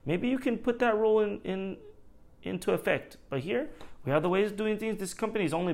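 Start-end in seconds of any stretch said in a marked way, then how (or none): 0.0-3.2 mock sweet sarcasm, pitched much higher; fake-polite jab at a know-it-all; imagined snarky retort to a customer; sarcastic comeback. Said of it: "I always wanna be like".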